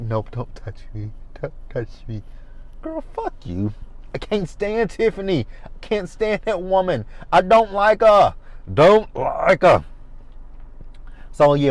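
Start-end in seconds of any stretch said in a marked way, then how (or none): 0.0-2.2 mock tearful refusal. Voicing Tiffany crying, pushing away. 2.8-9.8 exasperated disdain. Real, not acted. Host's own outburst at Tiffany.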